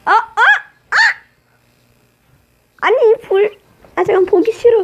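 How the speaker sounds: high, loud yelps of panic; real yelp of dismay. Real, not acted. Dying in game.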